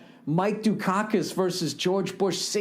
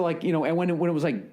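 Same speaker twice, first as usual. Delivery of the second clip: ordinary delivery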